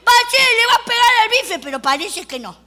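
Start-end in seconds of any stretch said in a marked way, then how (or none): 0.0-1.6 high, loud, eager cry; excited exclamation. Retold or imitated. Girl expects the hammer to hit, excited. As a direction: blurt it out thrilled. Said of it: "Así que dije".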